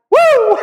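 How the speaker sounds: loud, high preacher's whoop; exultant whoop. Real, not acted. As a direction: let out a big whoop.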